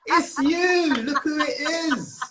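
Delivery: high, loud, laughing "it's you!"; excited greeting. Real, not acted. Greeting his friend as she appears on the live, high and laughing.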